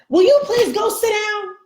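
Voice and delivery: put-on sudden high yell at the kids; mom yelling. Mom's calm act cracks, yelling at her kids loudly. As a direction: snap from calm into a shout.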